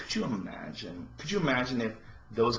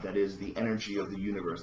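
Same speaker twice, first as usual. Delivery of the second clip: ordinary talk, nothing marked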